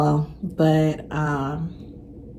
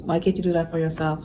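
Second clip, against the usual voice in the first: softer; 3 semitones lower, 9 dB quieter, faster than usual. Shown as a demonstration.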